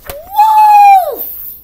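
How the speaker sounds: very high, very loud long yell of wolf; full alarm scream. Acted. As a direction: scream it to the whole valley. Betsy yells the alarm.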